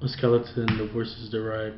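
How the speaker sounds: flat, mumbled, no emotion; bad acting. Shown as a demonstration. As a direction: read it like you don't care. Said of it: "a person that would just give no emotion whatsoever".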